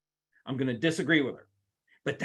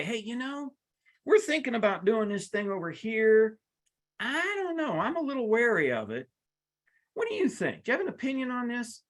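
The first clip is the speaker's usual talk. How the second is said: much higher, mock-friendly asking voice; asking Betty's opinion; 7 semitones higher than usual. Boss sounding out Betty on a plan, in a higher, coaxing voice. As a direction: coax an opinion out of a wary employee. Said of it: "I just say".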